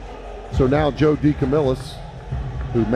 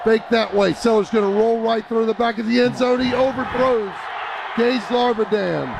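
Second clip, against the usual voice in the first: fast, raised radio play-by-play; live play-by-play; 5 semitones higher than usual. Real, not acted. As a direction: call the play as it happens.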